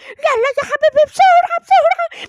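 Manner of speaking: high, loud frantic urging; panicked hurry. Acted. Scared grandpa hurrying his grandson before grandma comes, frantic. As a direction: rush them off in a rising panic.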